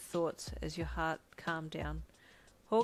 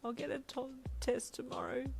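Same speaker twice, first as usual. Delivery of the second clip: high, whimpering wail; pitched-up tearful whine; 7 semitones higher than usual. A narrator's reading. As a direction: wail it weakly. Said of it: "you wailed".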